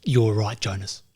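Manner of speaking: low, quiet action-hero impersonation; Jason Statham. Acted. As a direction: imitate a movie tough guy, low and terse. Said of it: "Jason Statham to say".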